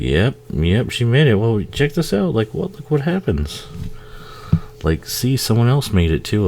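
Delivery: high, mock-eager uncle voice; teasing uncle. Uncle baiting his nephew before the scare, high and teasing. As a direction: egg the kid on, high and sly.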